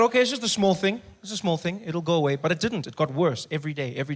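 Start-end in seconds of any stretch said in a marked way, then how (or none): 0.0-2.4 breezy self-assurance, shrugging it off; dismissive. Retold or imitated. Telling himself his lost voice is nothing, breezy. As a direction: brush it aside, high and loud. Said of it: "at first I thought".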